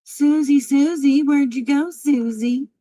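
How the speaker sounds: high, loud sing-song calling; mocking loud call for Susie; taunting call. Real, not acted. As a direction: call her back like a lost pet. Taunting call for departed viewer.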